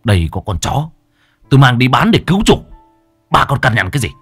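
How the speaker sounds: angry son. Acted. Son rebukes his mother, rising loud and fast. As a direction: throw the blame back at her.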